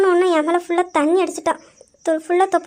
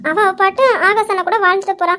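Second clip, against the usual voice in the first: usual speaking voice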